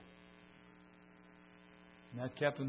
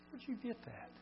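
high surprised question, still soft; 10 semitones higher, 8 dB quieter than usual. Retold or imitated. Soldier who finds his lost candy box, surprised and high. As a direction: ask in sudden disbelief.

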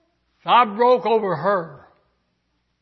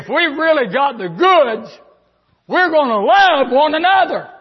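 raised, ringing preacher's cry; preaching at full force; 6 semitones higher, 7 dB louder than usual. Acted. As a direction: preach it out loud to the church. Preaching builds to a high cry.

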